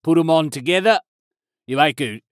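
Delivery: hammed-up mock Australian accent. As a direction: lay on a cartoon Aussie voice, mate. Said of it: "If you go to Australia".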